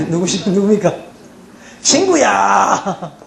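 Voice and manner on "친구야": long, stretched comic holler; drawn-out call. Acted. Comedian mimicking a Gyeongsang man calling his friend, drawn-out holler. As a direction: call out and hold the last syllable.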